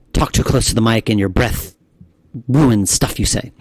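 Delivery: popping breath right into the mic; breath pops. Shown as a demonstration. Game developer showing a recording flaw, breath popping on the mic. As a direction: talk too close to the mic, plosives bursting. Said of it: "breath pops meaning stuff like this".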